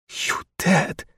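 intense, voiceless, held-in rage; woman accusing the spy in quiet fury. A narrator's reading. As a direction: hiss the accusation, furious but hushed. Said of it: "with an intense, quiet fury".

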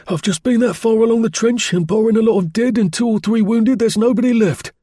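high, urgent soldier's report; excited sergeant major. Acted. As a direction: blurt it out like a shaken NCO.

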